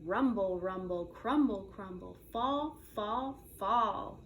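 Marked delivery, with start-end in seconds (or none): none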